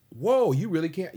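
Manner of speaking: high, loud, slow gushing whoa; mock amazed praise; excited friends gushing. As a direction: gush like an awed friend. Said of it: "Everybody's tripping".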